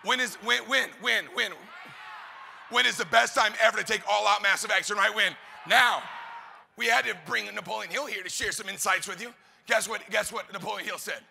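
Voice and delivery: ordinary talk, nothing marked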